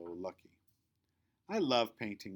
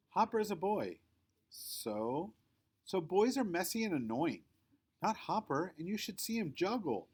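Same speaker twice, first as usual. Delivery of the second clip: put-on bossy girl voice; 8 semitones higher than usual. A narrator's reading. Girls arguing about boys.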